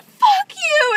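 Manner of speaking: high, loud mock shriek; imitating her yell. Retelling how the crazy woman yelled at them. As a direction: scream it like the crazy woman did. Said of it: "she was like".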